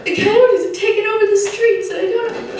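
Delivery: high, whiny mock old lady with a laugh; helpless old lady. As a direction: play the helpless old lady giving up her chair. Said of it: "That's what I hear when I hear".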